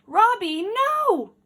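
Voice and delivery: high, loud cry of Robbie, no!; children shouting. Acted. Children shouting at the runaway robot, loud alarmed cry.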